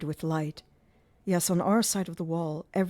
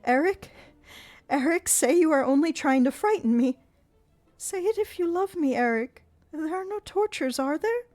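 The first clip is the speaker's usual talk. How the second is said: much higher desperate pleading; terrified begging; 11 semitones higher, 5 dB louder than usual. A narrator's reading. Christine begging Eric, high terrified voice. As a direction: beg him to deny it.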